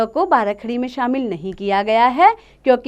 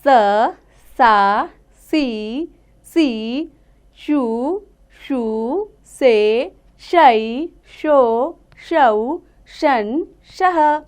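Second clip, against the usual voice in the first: slow, drawn-out teacher's chant of each syllable; slow syllable recitation; 3 dB louder, much slower than usual. Shown as a demonstration. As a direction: sound out every syllable slowly for children. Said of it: "अब हम पढ़ते हैं षटकोण वाले स की मात्रा को". (धीमे-धीमे अक्षरों का उच्चारण)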